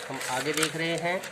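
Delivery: ordinary talk, nothing marked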